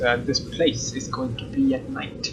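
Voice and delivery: hushed, breathy whisper of dread. Acted. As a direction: drop to a creepy whisper. Narrator whispers the dread line.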